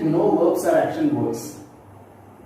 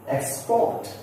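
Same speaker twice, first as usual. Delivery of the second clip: "export" rising onto a strong PORT; stress on second syllable; 8 semitones higher than usual. Shown as a demonstration. Teacher listing verbs for learners, second-syllable stress. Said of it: "give stress on the second syllable".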